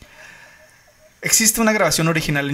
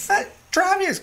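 panicked caller; slower than usual. Retold or imitated. Retold frantic 911 call.

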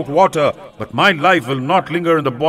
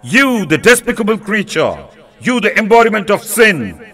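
raised, pleading fury of a wronged king; higher-pitched angry king's outburst, sliding into desperate pleading; wrathful censure; 4 semitones higher than usual. Acted. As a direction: rage at her, then beg at her feet. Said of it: "he broke out into wrathful censure".

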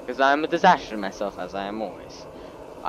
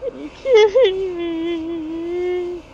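mock wailing cry; 7 dB louder, much slower than usual. Ship sinks yet again.

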